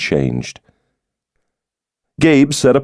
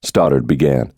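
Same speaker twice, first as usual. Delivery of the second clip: ordinary delivery, nothing marked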